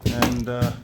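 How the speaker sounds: jolted voice, bouncing with the ride; on a bumpy road. Real, not acted. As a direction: talk while being shaken about. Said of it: "Sorry for the bumpy ride".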